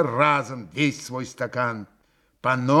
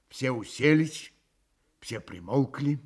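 plain reading voice

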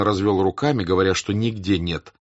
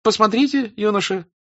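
high nervous; 12 semitones higher, 4 dB louder than usual. Acted. Telyanin offers purse.

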